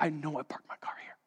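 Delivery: stunned whisper to himself. Retold or imitated. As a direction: breathe it out in disbelief. Panic finding car missing.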